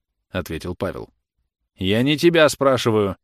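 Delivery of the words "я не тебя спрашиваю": louder, higher officer's reprimand; stern rebuke. A narrator's reading. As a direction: cut him down with authority. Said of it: "строго сказал офицер".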